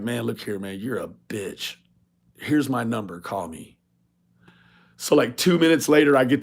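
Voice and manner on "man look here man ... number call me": low, quiet, sneering delivery; hushed mock taunt. Telling the rival off by email in a hushed sneer. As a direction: murmur the insult like a dare.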